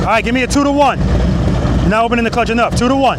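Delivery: loud, carrying cues across the practice lot; coaching calls. Real, not acted. Riding instructor shouting cues to a student on the bike, loud. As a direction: call the clutch rhythm out to the rider.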